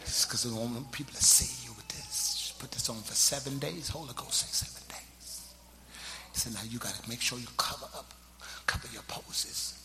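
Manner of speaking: hushed confidential whisper; very soft, low and secretive. Retold or imitated. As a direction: imitate a church mother whispering instructions. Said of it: "She was whispering".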